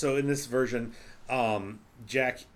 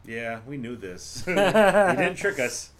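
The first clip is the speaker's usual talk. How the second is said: laughing retort; 2 semitones higher, 6 dB louder, much slower than usual. Retold or imitated. Mocking retort to the movie.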